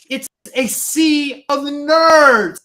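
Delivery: high, loud and very slow, drawn out for his friends; mocking loud announcement. Guy in the lobby hamming it up to his friend, drawn-out loud mock. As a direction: stretch every word out big and loud like a showman. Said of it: "And he's like".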